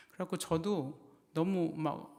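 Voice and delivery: ordinary delivery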